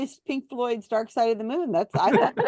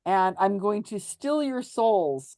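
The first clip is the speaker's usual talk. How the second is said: slow spooky photographer; 3 semitones lower, much slower than usual. Acted. Photographer joking about souls.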